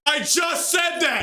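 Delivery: slow high yell, I called it; indignant shout. Real, not acted. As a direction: shout it out, vindicated. Shouting at narrator's reveal.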